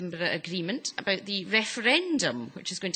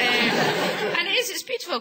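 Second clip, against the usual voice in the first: ordinary talk, nothing marked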